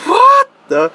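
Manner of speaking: retold stunned exclamation; incredulous. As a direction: blurt it high, utterly baffled. Retells his bafflement at the domestic.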